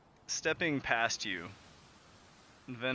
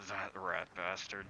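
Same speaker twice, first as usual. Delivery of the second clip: grumbling; 2 semitones lower, 6 dB quieter than usual. Acted. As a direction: grumble it under your breath. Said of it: "Venhold grumbling".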